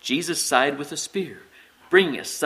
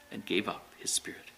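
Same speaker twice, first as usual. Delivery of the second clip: whispered, fading narration; hushed; 6 semitones lower, 12 dB quieter than usual. A narrator's reading. Narrator telling of the death on the cross, hushed. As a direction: let it fall away to a whisper.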